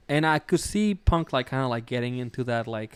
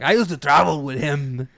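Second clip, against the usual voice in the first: a hammed-up Scottish accent, louder; imitating Drew in a Scottish accent; mock Scottish accent; 5 dB louder than usual. Imitating Drew McIntyre in a Scottish accent. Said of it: "Not doing a Scottish accent".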